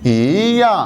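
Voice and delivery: ई या with ई rising, या falling; yí yà tone demo. Shown as a demonstration. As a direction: say ई in second tone before या. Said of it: "क्या कहेंगे?".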